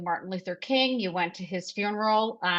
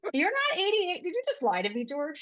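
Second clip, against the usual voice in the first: much higher, astonished cry; shocked disbelief; 9 semitones higher than usual. Real, not acted. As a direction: squeal in pure disbelief. Host shocked by his age.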